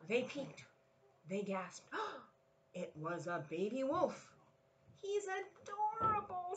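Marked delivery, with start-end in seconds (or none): 5.0-6.5 high, slow cooing mother bunny; cooing mama. Acted. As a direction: coo over the baby like a doting mother. Mother bunny voiced in story.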